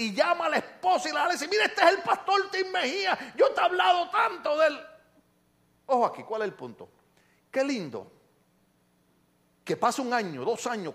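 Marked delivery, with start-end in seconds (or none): 1.5-4.8 put-on high, enthusiastic voice of an old friend; excited introduction. Old pastor presents his friend to his wife, excited introduction. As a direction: imitate an effusive, proud introduction.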